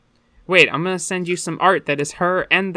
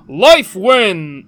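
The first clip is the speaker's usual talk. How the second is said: very loud heroic battle cry; botched tree creature impression; Garen-like; 10 dB louder than usual. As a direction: bellow it like a game hero. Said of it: "No, it's Garen".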